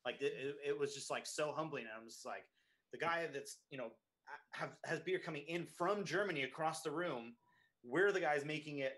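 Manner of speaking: ordinary talk, nothing marked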